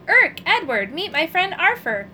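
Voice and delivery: cheerful introduction. A narrator's reading. Happy introduction.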